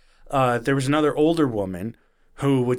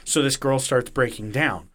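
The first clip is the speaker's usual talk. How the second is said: ordinary talk, nothing marked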